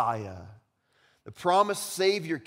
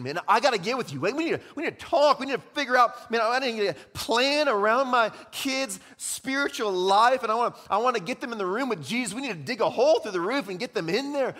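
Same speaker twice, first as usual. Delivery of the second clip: loud, fast, higher urgent pleading; urgent excited plea; 7 semitones higher, 5 dB louder than usual. Pretend. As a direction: beg for help in a rush.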